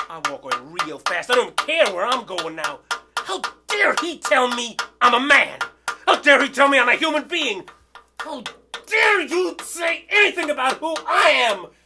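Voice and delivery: shouting in fury at the insult; furious shout. Real, not acted. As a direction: explode with wounded outrage. Outrage at being called a man.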